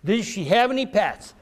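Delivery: ordinary talk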